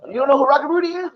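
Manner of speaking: high, quick disbelieving question; retold incredulous question. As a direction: ask it like it's unbelievable. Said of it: "I go".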